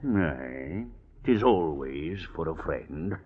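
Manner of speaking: lightly voiced old rogue, sly and easy; a sly old jailbird sizing up a young gentleman; old sea rogue. Old rascal Captain Rat talks to Mister Drew, sly and knowing.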